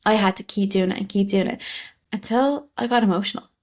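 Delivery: ordinary talk, nothing marked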